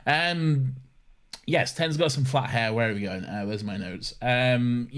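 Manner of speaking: ordinary talk, nothing marked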